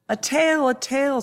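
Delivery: put-on high-pitched, excited young lamb; excited little lamb. A narrator's reading. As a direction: cry it out like a thrilled lamb. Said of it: "said the youngest of lambs".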